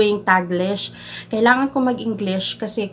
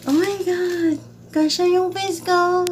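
higher, giddy oh my God; higher excited gush; delighted exclaim; 9 semitones higher than usual. Real, not acted. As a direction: burst out in amazed joy. Reacting to opened gift.

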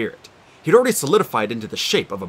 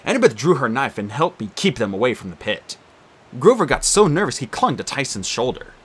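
ordinary delivery, nothing marked

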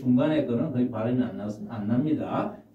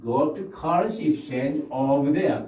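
very slow, clear; slow model reply; much slower than usual. Shown as a demonstration. Teacher modelling the clerk's answer slowly. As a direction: point the way slowly. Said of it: "teacher models reply".

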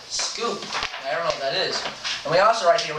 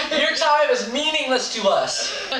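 laughing; 8 semitones higher, slower than usual. Pretend. Mock announcer line.

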